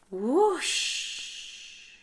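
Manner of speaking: a high, breathy whoosh of wind. Acted. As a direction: blow it like a gust. Narrator voices the wind.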